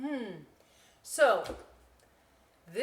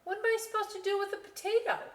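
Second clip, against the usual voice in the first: much higher, fast incredulous question; exasperated; 4 dB louder, faster than usual. Acted. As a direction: throw up your hands in disbelief. Girl exasperated.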